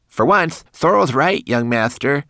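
high, loud little servant voice with a lisp; lisped, deferential agreement; lisping manikin. A narrator's reading. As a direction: speak up as a tiny lisping attendant. Twigleg's lisping character voice.

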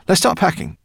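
high, loud and eager; rally them cheerfully; happy. A narrator's reading. Professor urging family to get packing, happy. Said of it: "said the professor happily".

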